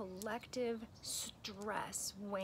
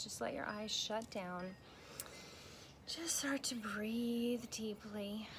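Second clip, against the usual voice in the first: slow, soft breathing guide with long pauses; guided meditation; 5 dB quieter than usual. Acted. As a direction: ease the listener into calm, one breath at a time.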